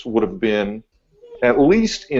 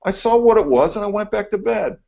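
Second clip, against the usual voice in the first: higher, casual mock voice; mock unbothered coworker; 10 semitones higher than usual. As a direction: shrug it off like it was nothing. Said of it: "and he goes".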